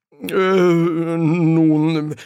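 high, drawn-out hesitant filler; embarrassed stalling. A narrator's reading. Angel dodging the serpent's question, embarrassed. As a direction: stall for time, awkwardly.

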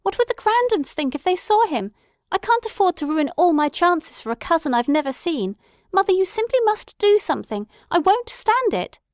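high, loud, indignant daughter; indignant protest. A narrator's reading. Daughter insisting her mother act, loud indignant protest. Luella protests the cousin's visit.

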